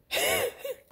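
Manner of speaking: short high-pitched giggle. Real, not acted. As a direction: giggle at your own slip. Laughing at own blooper.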